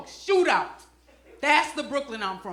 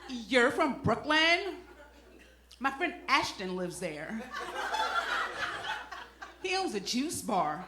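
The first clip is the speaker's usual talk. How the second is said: slow start, then soft and low; mock a trendy girl, quietly chatty; imitated clueless girl; 3 semitones lower, 8 dB quieter than usual. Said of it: "This girl was like".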